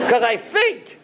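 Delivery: punchline through laughter; can barely deliver it for laughing. Real, not acted.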